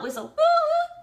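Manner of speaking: slow, high, loud mock whistle; whistle woo. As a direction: stretch the woo like a referee whistle. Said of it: "blowing the whistle".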